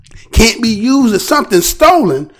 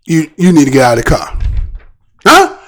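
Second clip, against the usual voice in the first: lower, fast stern command; stern officer order; 7 semitones lower, faster than usual. Retold or imitated. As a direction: bark the order like a cop. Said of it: "you know what that officer said?".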